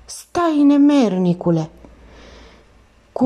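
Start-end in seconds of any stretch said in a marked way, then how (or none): 0.0-1.7 high, loud, drawn-out cry; girl screaming after her attacker; girl's scream. A narrator's reading. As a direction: cry out for him to stop, stretching the words. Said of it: "Angela țipă".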